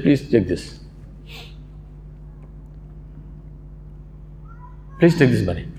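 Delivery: low, hushed entreaty; subdued plea. Retold or imitated. Cobbler offers his Hajj money to neighbour, subdued plea. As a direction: retell a humble plea, pitch dropped, with a long pause.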